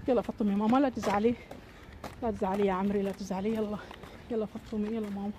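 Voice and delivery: gently calm the little one down; soft soothing. Acted. Mother hushing sad Fatouma, soft and tender.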